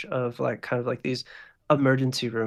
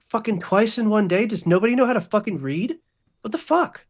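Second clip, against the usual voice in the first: high, louder incredulous complaint; exasperated outburst; 9 semitones higher, 5 dB louder than usual. Acted. Hospital workers staring at people running in, exasperated outburst. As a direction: play fed-up hospital workers, pitched way up.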